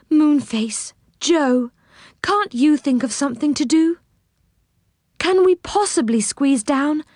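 a hint of a pleading fairy. A narrator's reading.

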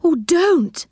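high-pitched character complaint; pained protest. A narrator's reading. Silky to Saucepan Man poking her, high protest. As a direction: object in a high little voice.

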